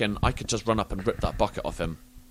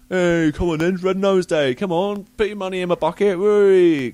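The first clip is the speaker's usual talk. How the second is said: mock charity shout; 6 semitones higher, 5 dB louder than usual. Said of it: "I wasn't shouting".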